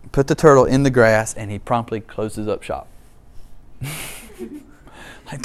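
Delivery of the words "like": ordinary talk, nothing marked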